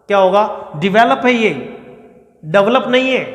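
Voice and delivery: normal talking voice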